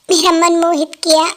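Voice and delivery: ordinary talk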